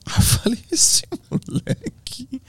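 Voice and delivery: slow, high whispered aside; hushed, drawn-out marvelling; whispered amazement. Retold or imitated. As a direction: whisper it, stretched out in mock awe. Aside marvelling at the boy's nerve.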